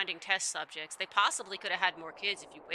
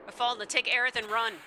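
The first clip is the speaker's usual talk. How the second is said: raised voice, urging escape; urgent warning. Acted. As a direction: cry out an acted warning.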